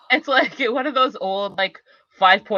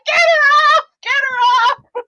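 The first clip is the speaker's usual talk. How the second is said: high panicked shout; panicked high yell; 3 dB louder than usual. Retold or imitated. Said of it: "he's like".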